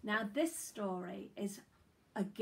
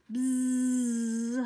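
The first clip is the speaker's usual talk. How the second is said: long, loud bee buzz; humming bee buzz; 4 semitones higher, 9 dB louder than usual. A narrator's reading. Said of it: "hummed Honeybee".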